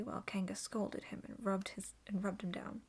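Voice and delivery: usual speaking voice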